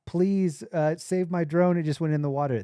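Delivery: high, loud desperate call across the beach; retold yell. Calling from the sand to surfers, loud pleading yell. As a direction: shout out to the surfers to save it. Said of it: "I'm, like, yelling to all these surfers".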